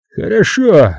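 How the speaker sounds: high, choked concession; forced-out, throttled assent; strangled croak. A narrator's reading. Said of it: "прохрипел Хатчинс, как будто его душили".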